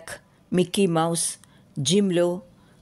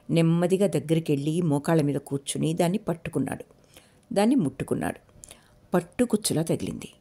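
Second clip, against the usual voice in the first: plain reading voice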